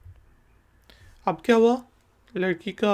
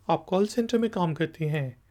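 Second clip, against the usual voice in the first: ordinary talk, nothing marked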